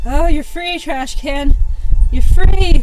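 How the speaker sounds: loud relieved cheer. Real, not acted. Relief after release.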